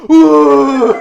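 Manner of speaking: long, loud, high shriek; mock terrified scream. Recruits grabbed on the log bridge, mock screaming. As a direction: scream in hammed-up fright. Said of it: "애들이 어떻게 되면".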